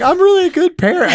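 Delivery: high, loud mock insistence; hammed-up parent protesting they are good; defensive parent.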